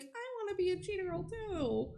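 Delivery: put-on high, soft little-kid plea; little sister. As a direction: imitate a small sister wanting in.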